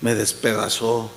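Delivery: low, subdued imitation of a broken man; understated anguish, pitched well down; retold tearful confession. Man confessing to his pastor beside his wife, low retold sorrow. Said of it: "estaban llorando los dos".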